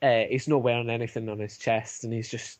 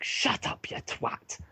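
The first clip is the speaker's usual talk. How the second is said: whispered rebuke; slower than usual. Acted. Guard silencing the other guard, whispered. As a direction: whisper a sharp hush.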